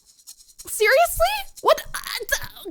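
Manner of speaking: very high, drawn-out disbelieving question; incredulous squeal. Real, not acted. Streamer reacting to another donation, incredulous squeal. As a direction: stretch it out high and loud in real shock.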